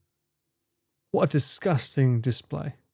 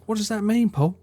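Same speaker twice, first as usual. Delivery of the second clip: mum voice; 7 semitones higher than usual. Retold or imitated. Mum voice again.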